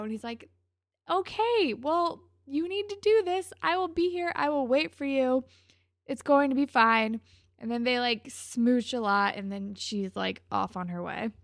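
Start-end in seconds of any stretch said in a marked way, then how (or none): 1.1-7.2 raised, emphatic pep talk; acted boyfriend's exasperated promise; insistent reassurance. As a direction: talk her round, higher and firm. Retold boyfriend answering her protest.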